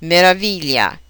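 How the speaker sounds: "meraviglia" as me-ra-VI-glia; stress third syllable. Shown as a demonstration. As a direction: lean on the VI of "meraviglia". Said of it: "on the third syllable".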